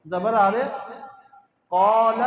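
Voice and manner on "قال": قال with the alif stretched and louder; drawn-out reading. Shown as a demonstration. Teacher models long alif for students. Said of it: "پڑھیں".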